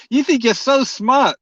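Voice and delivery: amused teasing. Real, not acted. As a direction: tease them slowly in a high, amused voice. Teasing a commenter after a laugh.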